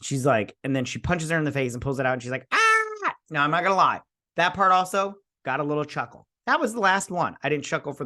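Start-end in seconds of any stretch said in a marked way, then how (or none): 2.5-3.1 short high-pitched imitated scream; mock shriek. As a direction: yelp it like her, very high. Imitates her shriek on being punched.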